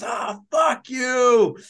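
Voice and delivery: high, loud, long-stretched mock scream; retold yell. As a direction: imitate a cramping runner's yelled curse, drawn out. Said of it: "yelling at his hamstring".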